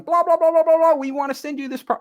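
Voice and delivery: imitating an agent pitching on the phone; mock agent. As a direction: parody a pushy Hollywood agent. Mocking a fast-talking agent.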